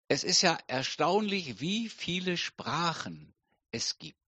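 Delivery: ordinary talk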